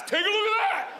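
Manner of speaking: high-pitched triumphant taunt; mock exhibitionist yell. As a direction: crow it like a cheeky show-off. Acting out daughter flashing the class.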